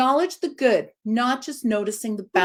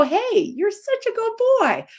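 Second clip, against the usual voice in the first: happy praise; 13 semitones higher than usual. Shown as a demonstration. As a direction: gush over the dog like you mean it. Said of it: "You should go".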